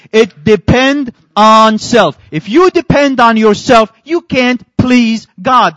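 loud, slow sermon emphasis; preaching. Acted. Preacher driving home the verse to the church, loud and slow. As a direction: preach it to the congregation.

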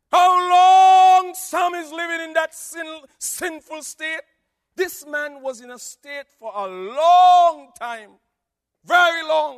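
loud, high sermon shouting; full force preaching. Acted. Preacher calling sinners out, shouting. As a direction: hammer the questions at the crowd.

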